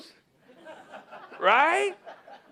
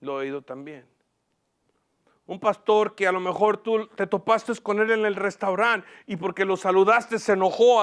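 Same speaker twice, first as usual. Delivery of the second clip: ordinary talk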